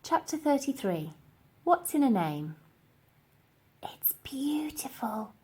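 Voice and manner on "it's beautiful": soft breathy whisper of wonder. A narrator's reading. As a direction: whisper it in awe. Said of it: "whispered the girl".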